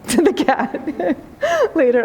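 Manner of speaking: words breaking into a laugh. Real, not acted. Teller laughs retelling the line.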